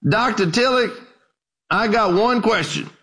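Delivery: a hint of an old country preacher speaking up. Old preacher standing to question the scholar, lightly voiced.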